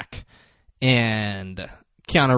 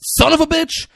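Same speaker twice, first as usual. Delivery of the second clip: high, raised mock fury; outraged exclamation; 4 dB louder than usual. Gunman about to shoot the driver window, outraged. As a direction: blurt the insult as anger boils over.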